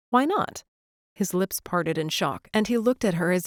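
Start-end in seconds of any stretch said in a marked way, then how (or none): 0.1-0.6 higher, bright defiant question; innocent challenge. A narrator's reading. Claire defying Liam on picking both, high and innocent. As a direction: ask it as if it's obvious.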